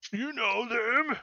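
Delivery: high, slow, put-on brute voice; gruff monster voice. Ogre asks the party if they know them, slow brute voice. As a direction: talk like a dim-witted brute. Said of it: "and says".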